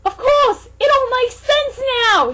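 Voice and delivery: L suddenly figuring it out, shouting; excited shout. Acted. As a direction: yell the eureka moment. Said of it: "shouted L".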